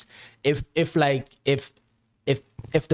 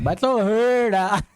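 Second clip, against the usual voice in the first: much higher, louder drawn-out cry; mock outcry; 12 semitones higher, 5 dB louder, slower than usual. Guest playfully calls out to the guys, high mock outcry. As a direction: wail it out loudly in a high voice.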